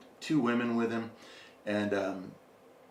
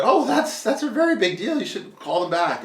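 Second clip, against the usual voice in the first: excited mom; 11 semitones higher, 5 dB louder than usual. Retold or imitated. Mom excited about famous photographer.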